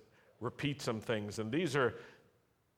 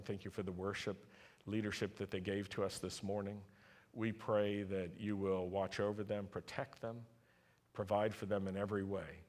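prayer; 3 semitones lower, 4 dB quieter than usual. Acted. Pastor praying softly over the congregation. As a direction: pray gently over the people. Prays for a family.

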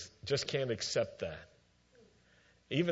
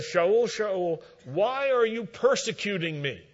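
higher and louder, a divine call from above; 8 semitones higher, 5 dB louder than usual. Acted. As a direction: call his name out across the road like a heavenly voice. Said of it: "a voice calling out".

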